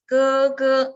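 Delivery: 哥哥 loud with a stressed second syllable; deliberately wrong, overstressed version; wrong full tone. Shown as a demonstration. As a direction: hit both syllables of 哥哥 hard. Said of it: "it's the wrong way".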